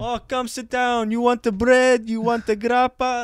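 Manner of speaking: a hint of a gushing restaurant owner; fawning host. Owner offering bread and grappa to Italian guest, mild fawning.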